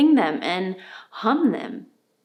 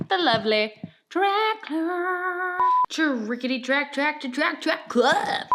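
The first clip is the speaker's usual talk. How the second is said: playful high voice; 8 semitones higher than usual. Acted. Playful sponsor name riff.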